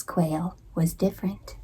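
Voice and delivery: hushed whisper. A narrator's reading. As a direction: lean in and whisper it. Narrator whispers discovery.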